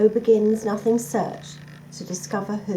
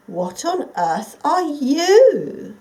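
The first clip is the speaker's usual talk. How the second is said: slow, smooth and higher, drawn out like a sly fox; silky fox voice; 6 semitones higher, 8 dB louder, much slower than usual. Acted. Fox finds Nothing in the garden, silky and slow. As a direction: purr it out, curious and sly. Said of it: "said a silky voice".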